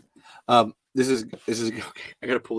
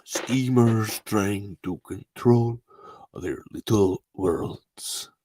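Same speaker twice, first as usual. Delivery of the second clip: slow, halting, low-key villain delivery; slow menacing quote; 4 semitones lower, 5 dB quieter, much slower than usual. Acted. Performer quoting a schemer's speech, slow and measured. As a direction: drag it out, slow and deliberate.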